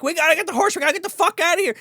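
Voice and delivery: high, urgent retold plea; high-pitched mock panic to get going; acted urgency. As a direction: hurry them along in a high voice. Retelling her urgent rush to flee.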